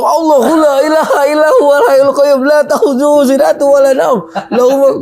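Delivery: high, comic panicked recitation; baca ayat kursi panik. Retold or imitated. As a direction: imitate a frightened man blurting out a prayer. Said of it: "kok dia baca ayat kursi". (tiruan baca doa panik, nada tinggi)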